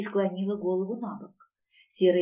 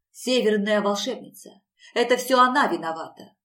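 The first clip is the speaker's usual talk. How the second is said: louder, heated outburst; angry accusation; 7 dB louder than usual. A narrator's reading. Woman blaming the northern sorceress, angrily. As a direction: raise the voice to blame her.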